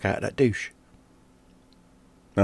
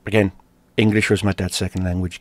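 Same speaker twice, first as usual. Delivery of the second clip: usual speaking voice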